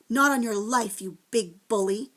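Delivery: plain reading voice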